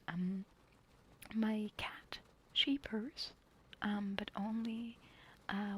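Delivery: usual speaking voice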